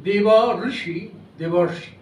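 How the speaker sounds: "দেব" and "ঋষি" kept apart, then "দেবর্ষি" with a consonantal r; sandhi demo again. Shown as a demonstration. Lecturer repeating the sandhi example for students. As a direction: split "দেব ঋষি", then run them together as "দেবর্ষি". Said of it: "ব্যঞ্জন ধর্ম প্রাপ্ত হতো".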